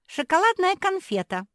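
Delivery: very high, loud happy squeal; delighted. A narrator's reading. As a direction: burst in gleefully, way up high. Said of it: "обрадовалась Мафи".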